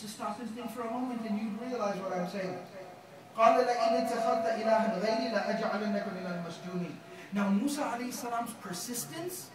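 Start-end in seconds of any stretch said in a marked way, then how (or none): 3.3-6.9 loud, fast chanted verse; تلاوة; Quran recitation. Acted. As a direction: recite it firmly.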